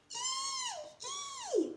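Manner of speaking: very high squealing pig. Acted. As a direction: squeal like a piglet. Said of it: "the pig squeals".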